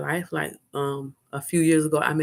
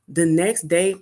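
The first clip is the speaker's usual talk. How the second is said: ordinary delivery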